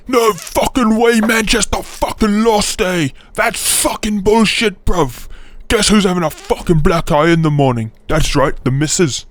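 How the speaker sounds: high-pitched, badly faked British rant; mock an outraged British fan; angry British football fan. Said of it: "British football fans will unironically sound like this".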